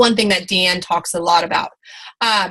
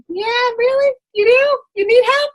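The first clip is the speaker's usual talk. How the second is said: much higher, mocking disbelief; sarcastic mock surprise; 13 semitones higher than usual. Sponsor mocking a friend who left her team, high sarcastic voice. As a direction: pitch it way up, dripping sarcasm. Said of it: "she's like, "Hey, I need help".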